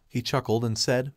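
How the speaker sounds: ordinary delivery, nothing marked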